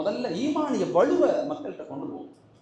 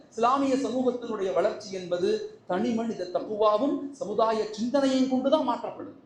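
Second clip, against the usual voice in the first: ordinary delivery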